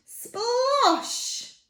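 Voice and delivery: high, drawn-out "splosh"; big splash. Acted. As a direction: sound out a splash with a high swoop. Splash into the duck pond.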